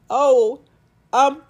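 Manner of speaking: halting, pause-filled mock reluctance; stall, then half-heartedly come round; awkward hesitation. Heroine answering a love confession, awkwardly hesitant.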